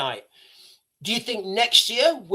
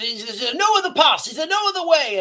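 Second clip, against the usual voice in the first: Aragorn's plea; 8 semitones higher, 3 dB louder than usual. Retold or imitated. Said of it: "he says".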